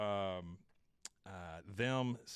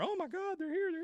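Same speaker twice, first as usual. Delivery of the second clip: much higher, breathless crowd gasping; excited crowd voice. Retold or imitated. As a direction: play the awed crowd spotting the lights. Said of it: "the crowd starts to murmur".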